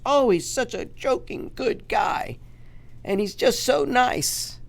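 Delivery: high, slow, syrupy falsetto mocking the reporters; mock gushing media. To his viewers, mocking media praise in a high, slow falsetto. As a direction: gush sarcastically like a fawning TV host. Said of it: "is going to tell you".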